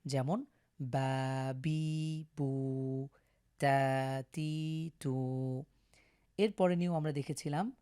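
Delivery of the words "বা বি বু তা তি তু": long vowels in ba, bi, bu; evenly lengthened a, i, u vowels; one-alif madd stretch. Shown as a demonstration. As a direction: hold each vowel steadily, one syllable at a time. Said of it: "এক আলিফ টেনে পড়ব".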